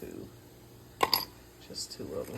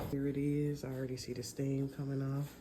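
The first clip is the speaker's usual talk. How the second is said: ordinary talk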